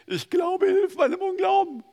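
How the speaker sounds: high, loud pleading cry; a high, strained cry for help; desperate cry. Retold or imitated. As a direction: cry it out like a plea. Quoted cry of faith, high.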